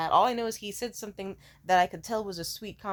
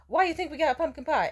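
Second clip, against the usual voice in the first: higher, louder mock waiter in a broken English accent; 9 semitones higher, 6 dB louder than usual. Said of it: "I know you might think that from the accent".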